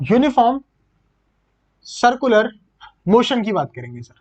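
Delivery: "Uniform", "circular", "motion" each set apart by long pauses; word by word. Shown as a demonstration. Teacher introducing the new topic to his class, pausing between each word. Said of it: "वो करेंगे sir uniform circular motion की".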